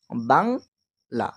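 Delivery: বাংলা split slowly into two beats, বাং then লা; syllable by syllable. Shown as a demonstration. Teacher sounding out বাংলা for the class, slowly split. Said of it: "দুটো ঝোঁক হচ্ছে তাই না?".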